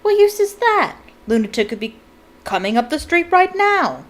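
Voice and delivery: loud, high angry bark. A narrator's reading. Uncle barking at the reporter on TV, loud and high. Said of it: "barked Uncle Vernon".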